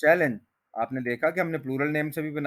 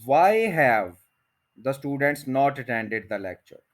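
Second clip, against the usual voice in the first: slow model question; much slower than usual. Shown as a demonstration. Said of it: "teacher models slowly".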